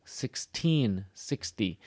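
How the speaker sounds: slow, long second syllable in "sixteen", short in "sixty"; careful minimal pair "sixteen sixty"; contrasting "sixteen" and "sixty". Shown as a demonstration. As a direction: stretch "-teen", then clip "sixty". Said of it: "how to do these things".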